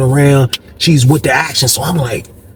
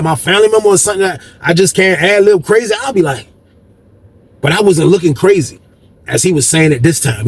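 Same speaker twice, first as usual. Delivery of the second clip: ordinary talk, nothing marked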